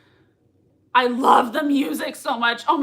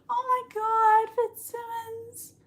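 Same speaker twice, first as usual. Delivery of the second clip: much higher, slow, fading to a near hush; breathless awe; 11 semitones higher, slower than usual. Real, not acted. Fan overcome by the reunion, awed high murmur. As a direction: let it fade out in awe.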